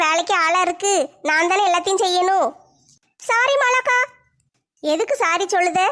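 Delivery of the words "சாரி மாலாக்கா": put-on higher, childlike younger sister complaining; whiny kid sister, voice raised high. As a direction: pitch up as the weary little sister.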